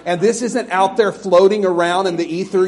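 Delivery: normal talking voice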